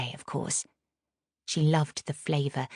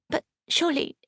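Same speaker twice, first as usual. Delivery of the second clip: much higher, flustered protest; spluttering; 12 semitones higher than usual. Acted. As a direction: splutter it in shock. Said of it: "Nurse Cowdray spluttered".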